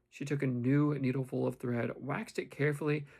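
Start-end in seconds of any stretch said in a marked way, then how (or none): none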